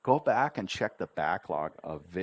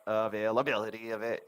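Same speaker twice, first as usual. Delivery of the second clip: "Availability" rattled off fast; rushed; faster than usual. Shown as a demonstration. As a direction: gabble "Availability" in a hurry. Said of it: "But if you rush it".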